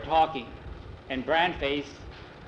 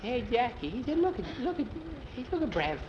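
put-on high excited boy voice; 9 semitones higher, 4 dB quieter than usual. Boy whispers excitedly to friend.

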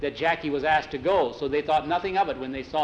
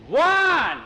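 hoch und laut zurückrufen; freudiger Ruf; 14 semitones higher, 8 dB louder than usual. Acted. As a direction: ruf den Freund voller Freude. Jackie calls back on reunion.

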